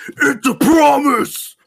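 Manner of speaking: high, drawn-out theatrical yell; loud acting voice. Shown as a demonstration. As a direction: belt it out slowly like a hammed-up actor. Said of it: "your acting voice".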